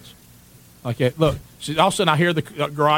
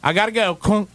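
ordinary talk, nothing marked

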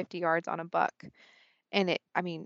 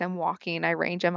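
normal talking voice

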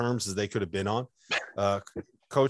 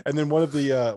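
ordinary delivery

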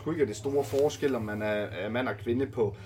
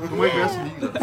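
high-pitched laughing protest; 13 semitones higher, faster than usual. Real, not acted. Coach teasing player for being indifferent, laughing. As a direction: protest through the giggles, pitch shooting up.

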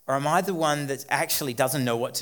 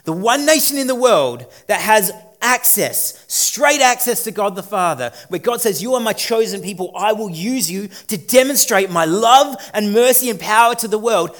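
raised, louder preaching build; fervent preaching; 5 semitones higher, 6 dB louder than usual. Real, not acted. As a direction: press the point home to the congregation. Preaching at full force.

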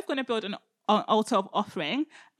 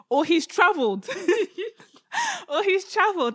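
higher, louder, breaking into laughter; amused mockery; 8 semitones higher, 4 dB louder than usual. Elijah suggesting their god is away, laughing mockery. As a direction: crack up at your own jibe. Mock excuse, cracking up laughing.

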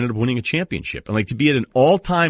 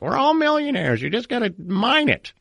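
much higher put-on old prospector voice; Gold Rush grandfather impression; 14 semitones higher than usual. Grandfather promising the kids riches, high put-on voice. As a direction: crow it like a gold-crazy grandpa. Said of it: "my Gold Rush impersonation".

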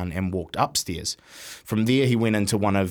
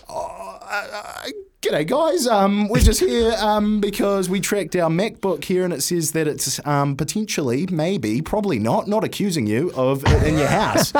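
much higher, wobbly, scared mock plea; nervous squeak; 10 semitones higher than usual. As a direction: beg timidly, like facing two big tattooed blokes. Timid plea to tattooed blokes.

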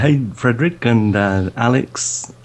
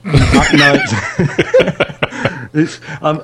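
laughing; 7 semitones higher, much slower than usual. Real, not acted. Laughs at teasing question.

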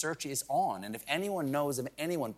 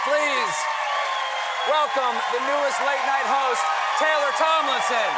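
booming host announcement, higher and louder; announcer introduction; 11 semitones higher, 7 dB louder than usual. Acted. Host announcing the new late night host to the crowd, booming announcer. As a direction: present her like a big showbiz reveal.

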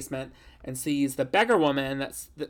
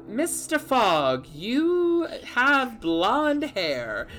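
higher, drawn-out mock voice; put-on character voice; 7 semitones higher, much slower than usual. Retelling the musical to a friend, slow high mock voice. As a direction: stretch the words out slowly in a silly put-on voice.

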